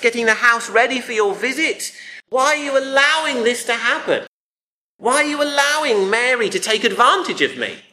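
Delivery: high-pitched, mounting indignant rant; aggrieved accusation. Acted. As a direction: pile up reproaches as the overworked sister. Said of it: "is her accusation".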